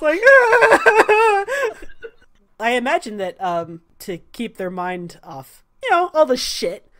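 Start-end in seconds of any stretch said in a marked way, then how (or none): 0.2-1.4 high, loud drawn-out wail; mock-panicked howl; comic scream. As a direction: let out a hammed-up panicked scream. Sal's wordless reaction to good talk.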